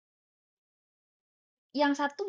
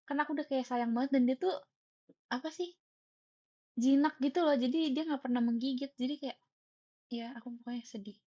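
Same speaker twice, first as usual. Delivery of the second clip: ordinary talk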